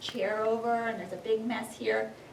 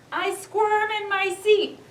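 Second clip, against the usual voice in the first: squirming drama; 9 semitones higher, 4 dB louder than usual. A narrator's reading. Building suspense in story.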